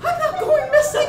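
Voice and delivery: high, loud protest; mock alarm. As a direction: squeal it in comic alarm. Alarmed reply to ranger's warning.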